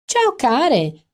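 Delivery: high loud trill; trilling greeting. A narrator's reading. Amanda hailing her friends across the bar, trilling. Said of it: "trillò dal fondo del bar".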